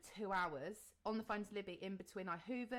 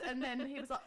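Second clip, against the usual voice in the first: ordinary talk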